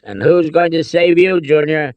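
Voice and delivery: roaring. A narrator's reading. Father turns on his son, roaring. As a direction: roar it, loud and high. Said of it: "Henry roared".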